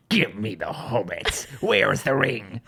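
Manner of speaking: low, slow, growling monster demanding the hobbits; creepy orc voice. Retold or imitated. Retelling her mirror impression to friends, low slow orc growl. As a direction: drag it out low like a hungry orc. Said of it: "the voices you just made".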